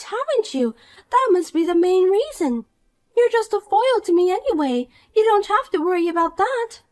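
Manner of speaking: put-on snide rich girl. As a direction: talk down to her with sweet contempt. Poppy insists and mocks.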